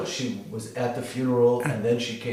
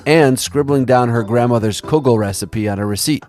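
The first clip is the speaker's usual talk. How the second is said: louder, polished storytelling read over music; a documentary host's heartfelt closing read; podcast narration; 7 dB louder than usual. Acted. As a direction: narrate it warmly to the audience.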